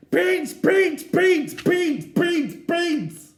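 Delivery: put-on high, loud, wailing toddler chant; toddler screaming. Little Eddie screaming for beans in the supermarket. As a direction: scream it like a furious little boy.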